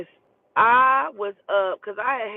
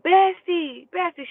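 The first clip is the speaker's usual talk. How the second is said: high, urgent repeated call; imitated calling out; 9 semitones higher, 3 dB louder, slower than usual. Woman trying to catch her friend, imitated calling. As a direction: holler the nickname high, then ease off.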